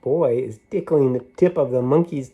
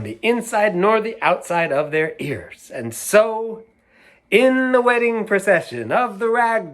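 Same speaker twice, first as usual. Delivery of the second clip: bright, raised storytelling voice; excited story narration; 8 semitones higher, 4 dB louder than usual. A narrator's reading. Reading a parade story to children, lively and raised. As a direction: read it like a thrilling parade.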